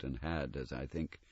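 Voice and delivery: very soft, low spirit voice; hushed ghost. Acted. The ghost to Scrooge about his nephew, hushed. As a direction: murmur it gravely.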